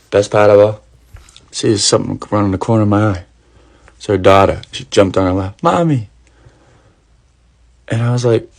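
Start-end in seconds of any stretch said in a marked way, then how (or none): 5.6-6.1 put-on child calling mommy. Child runs to her mother.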